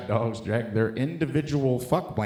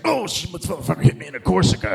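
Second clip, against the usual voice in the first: dog voice; 8 semitones higher, 4 dB louder than usual. Retold or imitated. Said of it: "He was just like".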